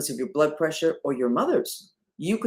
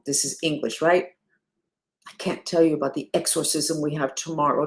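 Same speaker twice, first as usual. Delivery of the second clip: ordinary talk, nothing marked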